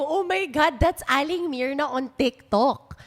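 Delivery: starstruck gasp, slow and loud; thrilled fan spotting a TikTok star; excited. Retold or imitated. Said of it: "sabi ko".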